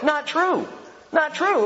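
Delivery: high, forceful not true; insistent rebuttal; emphatic denial. Real, not acted. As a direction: knock the claim down hard. Rebutting the article.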